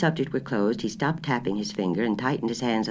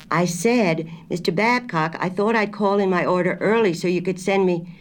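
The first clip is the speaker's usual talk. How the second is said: higher and louder, a little raised; 7 semitones higher, 3 dB louder than usual. A narrator's reading. Wife repeating her order louder to grocer. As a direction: speak up so he can hear. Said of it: "Missus Allison raised her voice a little".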